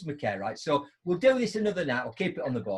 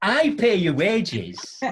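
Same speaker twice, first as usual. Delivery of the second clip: emphatic retort; 5 semitones higher, 7 dB louder, much slower than usual. Retold or imitated. Pointed retort.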